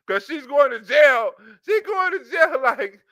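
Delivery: ordinary talk, nothing marked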